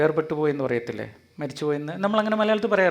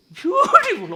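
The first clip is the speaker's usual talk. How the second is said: much higher, louder squeal of delight; delighted Eve; 6 dB louder, slower than usual. Acted. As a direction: gush like a thrilled girl. (ഹവ്വ ആനന്ദത്തോടെ കൂവുന്നു)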